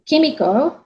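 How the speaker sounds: ordinary talk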